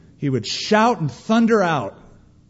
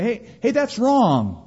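higher, slow objection; mock protest; 7 semitones higher, slower than usual. Believer standing up in the country, higher slow mild protest. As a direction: call it out with raised pitch, unhurried.

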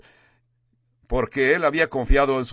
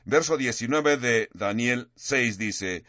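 ordinary talk

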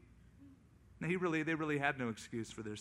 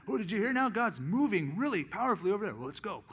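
higher and louder, breathless gossip; mock eager thrill seeker; 5 semitones higher, 6 dB louder, faster than usual. Churchgoer telling a friend about a hotter revival, higher and louder. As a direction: hype up the next revival.

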